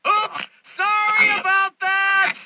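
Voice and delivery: high, loud drawn-out gloating cry; mock apology shout. Villain hindering Batman, mock apology shouted. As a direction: shout a gleefully insincere apology.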